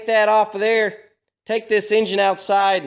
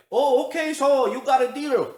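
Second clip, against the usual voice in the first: higher, a light mock German accent; lightly put-on German accent, higher; 6 semitones higher than usual. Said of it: "They got a little German accent".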